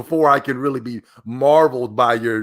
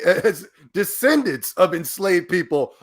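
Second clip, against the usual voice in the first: normal talking voice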